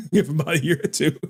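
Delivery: fast, breaking into laughter; repeating the joke while cracking up; laughing repeat. Retold or imitated.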